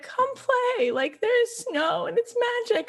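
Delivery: high, urgent plea to a sibling; pleading invitation. Acted. Sister coaxing a withdrawn sister to play, high pleading. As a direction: beg her to come out and play. Pleading child from film acted out.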